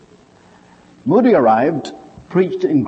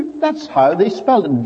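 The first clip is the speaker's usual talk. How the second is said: usual speaking voice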